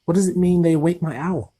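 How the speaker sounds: high, fast hurried question; boy stammering a question to the giant; stammered. Acted. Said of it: "he stammered".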